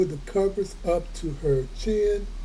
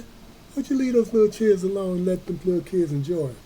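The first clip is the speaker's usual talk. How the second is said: plain reading voice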